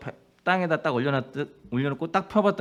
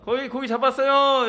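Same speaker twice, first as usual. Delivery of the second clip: high, loud call across the water; calling out from shore; 10 semitones higher, 5 dB louder than usual. Acted. Jesus calling out to the fishermen from the shore, loud. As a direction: shout the question to the far boat.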